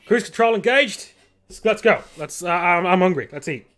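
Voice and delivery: Hyde hungry pilot. Acted. Pilot leaves the cockpit to eat, high eager voice. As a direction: announce autopilot then rush to food. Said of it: "and he's like".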